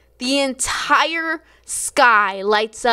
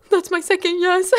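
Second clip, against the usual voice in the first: excited; 9 semitones higher than usual. Retold or imitated. Excited retelling, laugh follows.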